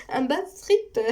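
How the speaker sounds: "Un bad trip" said high with a laugh. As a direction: giggle through "un bad trip vers la liberté". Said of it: "j'avais dit un bad trip".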